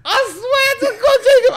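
very high, squeaky plea through laughter; real tearful laughing fit; helpless laughter. Real, not acted. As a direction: swear it while cracking up. Cracking up, swearing it is true.

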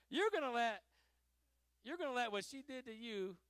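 loud, high-pitched challenge; retold rebuke. Pastor challenging his grudge-holding friend, loud incredulous rebuke.

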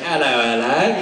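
put-on crazy mixed-accent greeting with rising tune; Sebastian. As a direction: mimic a mongrel, unplaceable accent. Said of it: "grab bag of accents".

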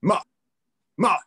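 short, forceful falling "ma", demonstrated; angry tone. Shown as a demonstration. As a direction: shout "ma" angrily, pitch dropping sharply. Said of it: "like, shouting it angrily".

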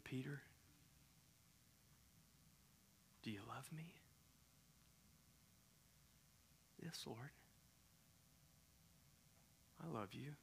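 soft, near-whispered tender questions; hushed tender exchange. Retold or imitated. Jesus quietly asking Peter about love, soft and tender. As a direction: hush the room, ask gently.

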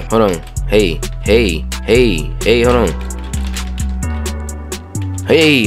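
ordinary talk

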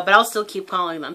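usual speaking voice

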